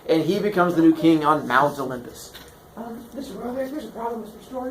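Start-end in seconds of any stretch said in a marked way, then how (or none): 2.8-4.7 soft, high student voice; small, high-pitched interruption; timid student. Acted. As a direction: hesitantly raise a problem with teacher. Acted student raises problem.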